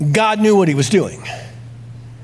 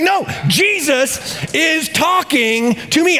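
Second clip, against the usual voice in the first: much higher, slow and insistent on No; exasperated exclaiming; 11 semitones higher, slower than usual. Pretend. Pastor insists to Pastor George, high and exasperated. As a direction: drag it out, desperate to be believed. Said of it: "I'd be exclaiming".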